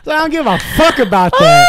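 high, loud dismissal; retold fed-up outburst; exasperated mock yell. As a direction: shout it like you've lost all patience.

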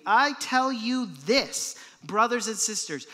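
"I tell you this" hammered out slowly and emphatically; emphatic. Acted. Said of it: "It's emphatic".

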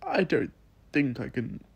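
high, tearful voice close to crying; choking back tears as she says it; tearful pleading. Acted. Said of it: "I began to cry".

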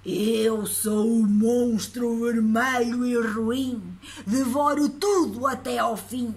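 slow, loud, growling monster; scary monster voice. Acted. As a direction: boom it out like a hungry monster. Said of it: "ouviu-se aquela voz".

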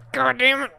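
normal talking voice

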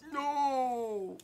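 high, startled no; mock protest. Real, not acted. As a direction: cry out in playful denial. Reacting to chat teasing.

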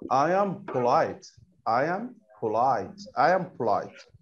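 I am polite said slowly, each word apart; slow model reading. Shown as a demonstration. Teacher to pupils, slow clear model of the phrase. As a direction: spell it out slowly for the class. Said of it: "هيقولها إزاي؟".

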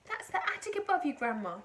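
calling out. A narrator's reading. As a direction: call up to Grandma, high and loud. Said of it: "George called out".

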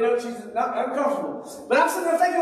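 usual speaking voice

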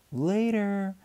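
put-on girl shouting back to her mother. A narrator's reading. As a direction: toss it back while running. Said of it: "Tina shouted over her shoulder".

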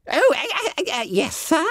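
high squeaky startled stammer; flustered fat schoolboy. A narrator's reading. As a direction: squeal it, caught out. Bunter startled by teacher.

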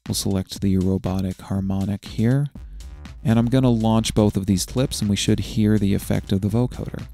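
ordinary talk, nothing marked

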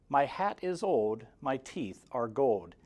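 plain reading voice

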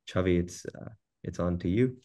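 ordinary talk, nothing marked

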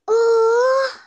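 sharp gasp into a high "oh"; gasp, then let out a high cry. Real, not acted. Startled reaction while playing.

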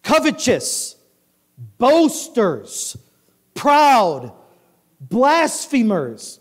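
high, punched list, word by word; emphatic scripture reading. A narrator's reading. Preacher reads sins to congregation, emphatic. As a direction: hammer out each sin separately.

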